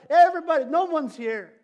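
high, loud mock lament about being left alone; self-pitying whine. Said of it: "Everybody's talked this way".

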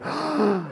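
sharp audible intake of breath; imitated gasp. Acting out the church's reaction, one airy gasp. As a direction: act out a shocked congregation's gasp. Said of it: "there was a gasp in the air".

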